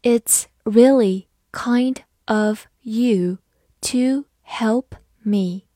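slow, careful classroom read; slow model reading for learners. Shown as a demonstration. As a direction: read each word slowly and clearly. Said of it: "好，慢慢来读".

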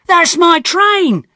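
high, loud, slow desperate cry; shouted. Acted. Tom shouting after his departing train, loud and high. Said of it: "I shouted".

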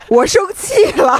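worked up, laughing through it. Real, not acted. Podcaster tells co-host how mad the story makes them, worked up.

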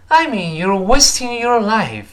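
"I mean you're wasting your life" high and slow, "wasting" and "life" stressed; emphatic stress. Shown as a demonstration. Teacher rereads for students to follow, "wasting" and "life" heavily stressed. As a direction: stretch "I mean you're wasting your life", punching "wasting" before a final fall. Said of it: "注意音调起伏".

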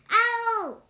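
high, loud shout of the name. A narrator's reading. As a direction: yell it up to the top floor. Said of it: "he shouted".

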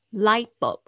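Light bulb loud, the t of "Light" held back and swallowed; soft held T. Shown as a demonstration. Teacher modelling the word for students, loud with the t held back. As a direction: say "Light bulb" with the t bitten off before bulb. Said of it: "你会发现它那个T很轻".

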